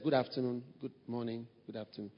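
soft, fading, emotionless greetings; quiet icy pleasantries; cold flat greetings. Retold or imitated. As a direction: greet him like he means nothing to you. Mimics cold greetings of Absalom.

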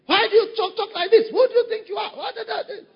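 high, loud indignant mock challenge; hammed-up indignant outburst; offended member. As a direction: bristle like someone who takes offense at nothing.

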